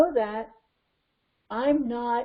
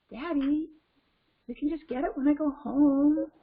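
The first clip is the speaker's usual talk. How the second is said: small, higher child voice, imitated; little girl; 5 semitones higher than usual. Girl to dad after flipping out, small child voice. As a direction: play a girl suddenly settled, pitched up. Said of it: "the girl just goes".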